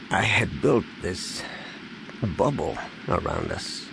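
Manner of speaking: soft and halting, pausing mid-phrase; quiet, grieving attempt to explain; struggling to explain. A narrator's reading. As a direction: grope for the words through held-back grief. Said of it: "Han tried to explain".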